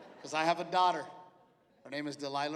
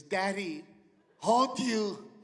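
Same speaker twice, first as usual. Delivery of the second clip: put-on a tiny girl asking to be held; little girl voice; 3 semitones higher, 3 dB louder, much slower than usual.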